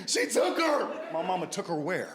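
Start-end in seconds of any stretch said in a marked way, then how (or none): 0.1-0.9 frantic uncle. Retold or imitated. Uncle panicking retold.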